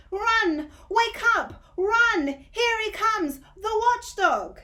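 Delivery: screaming alarm, everyone scattering; panicked shouting. Acted. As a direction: yell a warning as you flee. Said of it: "Great shouts filled the air".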